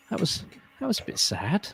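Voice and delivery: mock-wounded little understatement; retold choked-up reaction. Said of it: "like, choked me up".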